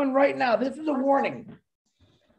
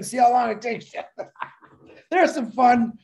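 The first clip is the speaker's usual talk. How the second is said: ordinary talk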